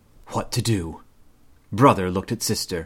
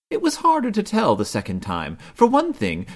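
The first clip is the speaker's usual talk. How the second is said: plain reading voice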